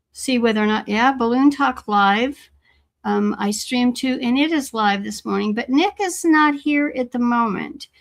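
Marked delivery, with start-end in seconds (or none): none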